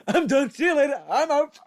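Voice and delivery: high, loud parting shots; mock walk-out. Podcaster jokingly quitting on the co-host, mock outrage. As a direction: announce a hammed-up exit.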